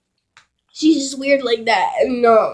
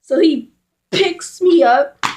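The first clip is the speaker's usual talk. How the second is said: ordinary delivery, nothing marked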